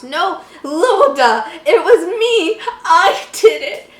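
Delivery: slow, loud, high mock crying; hammed-up sobbing. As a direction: overact the tearful confession. Jeff confessing in tears.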